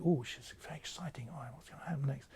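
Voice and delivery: put-on breathless whisper, like an eager child; whispered child's thoughts. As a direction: whisper it as a child wondering what comes next.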